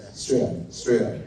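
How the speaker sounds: mock Australian accent on Australia. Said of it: "that's how they say it".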